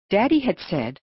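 usual speaking voice

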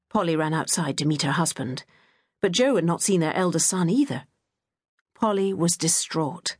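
ordinary delivery

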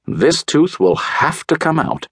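high, slow, deliberate announcement; announcing. A narrator's reading. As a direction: pronounce the verdict high and slowly. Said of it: "Doctor DeSoto announced".

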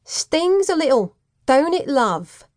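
high, loud gloating Cockney; Cockney accent. A narrator's reading. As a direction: taunt him in Cockney, slowing at the end. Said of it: "Whipcord grinned evilly".

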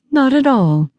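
mom's high, pleasant politeness; perfectly pleasant. Acted. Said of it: "My mom sounds perfectly pleasant".

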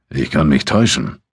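lightly offhand remark; underplay it, nearly casual; almost casual. A narrator's reading. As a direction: Abu Dun needling his friend, almost casually. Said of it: "sagte er in fast beiläufigem Ton".

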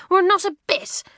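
put-on indignant girl. A narrator's reading. Pat to her twin, huffy indignant denial. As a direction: bristle at the idea.

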